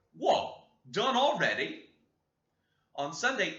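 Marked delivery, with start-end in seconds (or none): 0.1-1.9 loud, high indignant outburst; brother's indignant exclamation. Acted. Brother scolding the children for finishing prayers early, loud and indignant. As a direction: throw out a scornful, surprised cry. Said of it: "had the face to exclaim".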